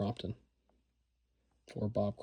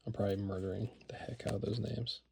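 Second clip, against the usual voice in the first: whispering; 10 dB quieter, faster than usual. Real, not acted. Embarrassed aside about the names.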